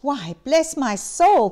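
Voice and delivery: startled delight; a touch of astonished joy; surprised cry. A narrator's reading. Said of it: "cried Fred".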